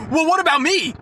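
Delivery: high, whiny imitation of a selfish customer; mock self-centred customer. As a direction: mimic her making it all about herself.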